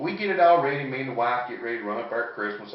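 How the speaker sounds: ordinary delivery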